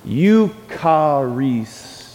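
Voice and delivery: Eucharist drawn out very slowly, YOO-kah-rees; stretched-out word. Shown as a demonstration. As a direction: stretch Eucharist so charis is heard inside. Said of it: "in the middle of that word".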